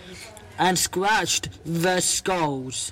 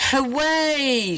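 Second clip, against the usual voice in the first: high delighted cheer; cheering hooray; 7 semitones higher than usual. Acted. Little skeleton celebrating the dog's rescue, high cheer. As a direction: cheer like a thrilled little one, higher. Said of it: "said the little skeleton".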